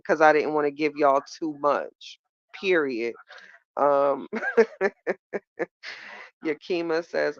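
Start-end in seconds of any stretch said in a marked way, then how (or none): 4.3-5.9 laugh. Real, not acted. Laughing at own singing.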